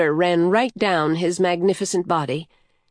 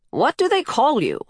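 teasing question with a big lift in pitch; playfully teasing, pitched up; 7 semitones higher than usual. A narrator's reading. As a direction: tease with a playful, high-swooping question. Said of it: "he teased".

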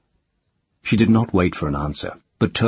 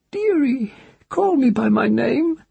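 high, thin pleading crone; a dying hag asks gently; old witch voice. Acted. Cursed witch asks the child to call her name, high crone voice.

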